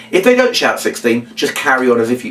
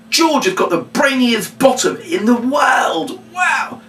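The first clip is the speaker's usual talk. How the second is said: high, slow showman's cry of wonder; milk it big for the crowd; excited announcement; 10 semitones higher, slower than usual. Shown as a demonstration. Entertainer announcing to the audience, high and excited.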